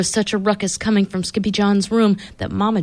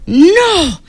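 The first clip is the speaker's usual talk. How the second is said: sharp outraged cry, higher; 9 semitones higher, 4 dB louder than usual. Acted. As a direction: shout it in outrage. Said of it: "cried Skipito, outraged".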